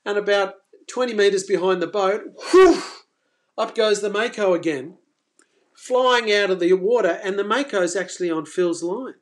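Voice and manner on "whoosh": whoosh sound. Retold or imitated. Shark bursting out of water.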